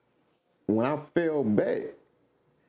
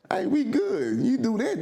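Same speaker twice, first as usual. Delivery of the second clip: high friendly mock greeting; 12 semitones higher than usual. Imitating someone calmly waving off an alien intruder, high and breezy. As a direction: toss it off like a relaxed neighbour.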